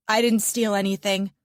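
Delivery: ordinary delivery, nothing marked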